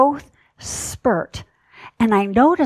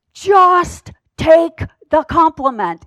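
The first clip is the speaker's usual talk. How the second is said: higher, louder, slow and firm; firm insistence; 6 semitones higher, 5 dB louder than usual. Retold or imitated. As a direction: press it home, no nonsense. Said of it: "well, I wouldn't say sweetly".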